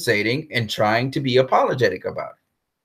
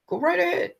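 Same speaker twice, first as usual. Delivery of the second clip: higher, softer sarcastic go right ahead; mocking invitation; 5 dB quieter than usual. Real, not acted. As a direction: taunt Warner Brothers sarcastically. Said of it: "repeated taunt".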